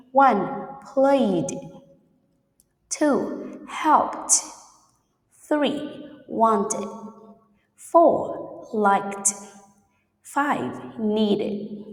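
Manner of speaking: number, pause, then the word said carefully; isolated words spoken distinctly for learners; dictating quiz words. Shown as a demonstration. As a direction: read out a listening quiz item by item. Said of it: "teacher will say it".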